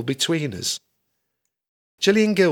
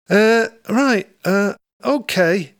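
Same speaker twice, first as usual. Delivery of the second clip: flustered; 8 semitones higher, 5 dB louder, slower than usual. Acted. Flustered reply.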